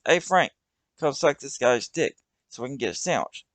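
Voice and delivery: shout. Acted. Doug shouting over to Frank. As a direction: holler across to his buddy. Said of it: "Doug shouts".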